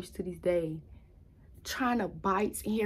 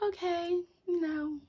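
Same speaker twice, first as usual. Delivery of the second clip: hopeful high self-talk; 10 semitones higher, slower than usual. Retold or imitated. Hopeful inner voice, awaiting score.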